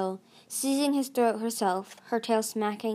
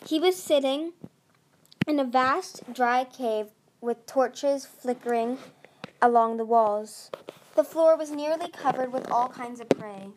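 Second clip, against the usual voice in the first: ordinary delivery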